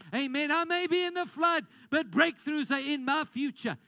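rousing preacher calling out; full-force preaching. Acted. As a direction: drive it home to the congregation.

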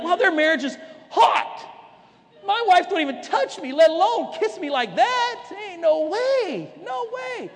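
high, whiny envious husband rising to a squeak; jealous husband. Retold or imitated. Husband envying a couple's marriage online, whiny and jealous. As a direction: play the man who envies another couple, lightly.

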